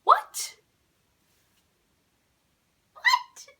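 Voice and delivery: very high, loud disbelief; incredulous squeal. Retold or imitated. Reacting to being told on, incredulous high squeal. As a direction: escalate into a squeaky, shocked question.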